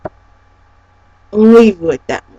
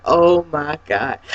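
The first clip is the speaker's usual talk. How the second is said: retold disbelief; 7 semitones lower, 7 dB quieter, slower than usual. Friends' weary reaction, retold.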